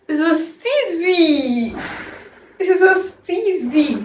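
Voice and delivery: almost crying over a gift, slow and high; teary joy. Real, not acted. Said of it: "I'm gonna cry".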